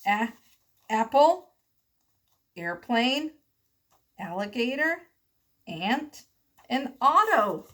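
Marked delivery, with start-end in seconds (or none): none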